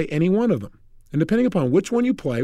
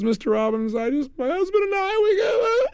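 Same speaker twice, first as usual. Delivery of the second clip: climbing into a very high, loose wail; mock crying; 14 semitones higher than usual. Woman bringing her husband problem to the seminar leader, mock crying. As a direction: imitate a wife dissolving into tears. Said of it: "and she starts to cry".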